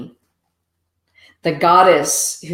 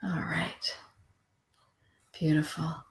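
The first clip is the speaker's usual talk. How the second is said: whispered to herself while checking the cards; 15 dB quieter than usual. Real, not acted. Whispering to herself while checking the cards. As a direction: breathe the words out, unvoiced and barely there.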